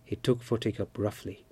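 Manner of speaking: ordinary delivery, nothing marked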